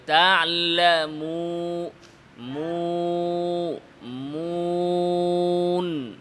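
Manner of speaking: তা'লামুন with rounded, pushed-forward lips. Shown as a demonstration. Teacher showing proper lip rounding, slow. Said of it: "সামনের দিকে নিয়ে চাপাতে হবে".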